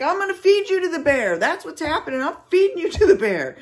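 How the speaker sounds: high, loud retort to a reckless buddy; exasperated mock threat. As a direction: yell it back in high-pitched exasperation. Retells exasperated threat to companion.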